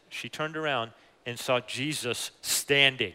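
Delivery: normal talking voice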